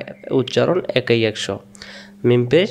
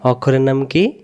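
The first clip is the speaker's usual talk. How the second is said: ordinary talk, nothing marked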